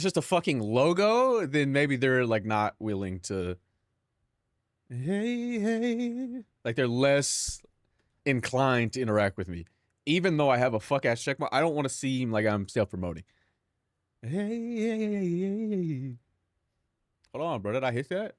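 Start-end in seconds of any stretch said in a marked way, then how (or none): 4.9-6.4 high, soft put-on "hey"; a goofy high voice greeting strangers; mock high greeting. Mock calling out to chats. 14.2-16.1 high, soft put-on "hey hey"; a goofy high voice calling out; mock high greeting.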